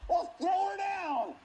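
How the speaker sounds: loud, high, slow order; commanding king. Retold or imitated. Jehu ordering the servants below, slow loud command. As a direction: bark the order down from the chariot. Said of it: "He said".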